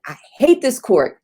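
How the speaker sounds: mock furious snap at the verdict; retold angry outburst. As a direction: relive the moment you snapped at the court. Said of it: "snapped in the court".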